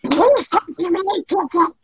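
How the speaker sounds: high quacking duck voice; Donald Duck voice. Pretend. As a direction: plead like a cartoon duck. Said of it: "You know".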